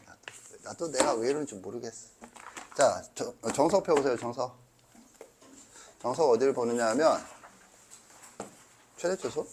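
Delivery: ordinary talk, nothing marked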